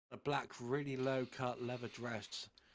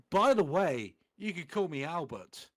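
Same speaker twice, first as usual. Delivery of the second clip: loud, higher cheery call; 4 semitones higher, 10 dB louder than usual. Acted. Dumbledore calls to students leaving, loud and bright. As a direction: call it across the hall. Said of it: "He called".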